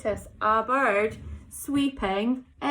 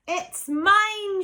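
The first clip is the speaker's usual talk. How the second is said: high, loud "mine!"; shout; 7 semitones higher, 5 dB louder than usual. Acted. Squirrel Cyril claims the pine cone, shouting. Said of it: "shouted Cyril".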